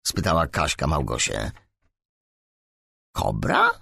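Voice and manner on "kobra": high questioning echo, rising; astonished. A narrator's reading. Małgosia hears a nickname for herself, astonished. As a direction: repeat it in surprise, pitched right up. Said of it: "powtórzyła Małgosia ze zdziwieniem".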